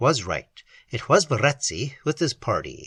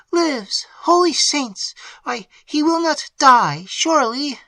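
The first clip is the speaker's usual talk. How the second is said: high, loud, frightened exclamation; alarmed maid; 13 semitones higher, 5 dB louder, slower than usual. A narrator's reading. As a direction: panic at the thought he may die. Annette alarmed by Emily's words.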